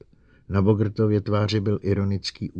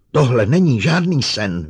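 loud, raised cry of denial; hysterical cry; 5 semitones higher, 9 dB louder than usual. A narrator's reading. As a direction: cry it out as hysteria takes hold. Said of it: "vykřikla".

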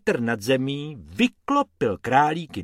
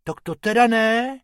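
protesting rabbit; 10 semitones higher, 4 dB louder than usual. Acted. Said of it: "protestoval Bobek".

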